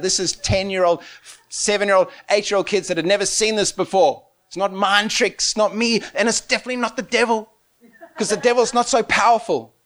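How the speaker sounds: usual speaking voice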